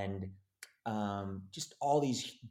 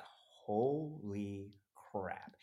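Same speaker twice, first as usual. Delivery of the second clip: slow, softer stunned reaction; 6 dB quieter, much slower than usual. Retold or imitated. Actor sees the packed audition room, stunned. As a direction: drag it out, quietly awestruck.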